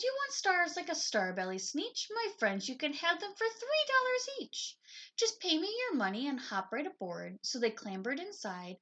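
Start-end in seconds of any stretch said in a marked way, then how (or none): 0.0-7.4 high, eager huckster voice; lightly voiced fast-talking salesman; salesman pitch. A narrator's reading. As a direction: sell it like a carnival barker. Salesman pitching his machine.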